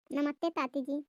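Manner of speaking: soft lisped greeting, k turned to t; lisping. Acted. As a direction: greet softly, lisping "ताती" for काकी. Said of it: "मुझे ऐसे थोड़ी आवाज़ फंस फंस सी लग रही थी". (तुतलाकर धीमे से)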